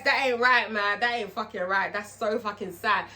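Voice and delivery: ordinary talk, nothing marked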